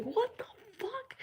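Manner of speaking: very high, hushed squeak of disbelief; stunned. Retold or imitated. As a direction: reenact awe in a small high voice. Retelling their stunned reaction.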